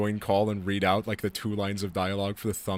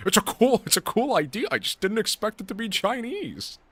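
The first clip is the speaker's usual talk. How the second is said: much higher mock voice; quoting a meme; 13 semitones higher, 5 dB louder than usual. Streamer repeating a community meme line, high mock voice. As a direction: parrot the meme in a silly high voice. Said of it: "What's even the original for that quote?".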